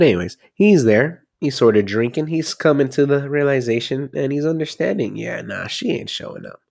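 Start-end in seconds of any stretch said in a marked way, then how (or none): 5.2-6.6 quiet resigned mutter. Retold or imitated. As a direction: mutter it, he's given up on her. Cop's resigned inner thought.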